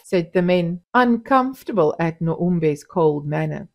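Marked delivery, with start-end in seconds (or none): none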